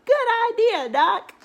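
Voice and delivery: very high, loud praise; excited cheer. Acted. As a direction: call it out up high with delight. Animals praise duck.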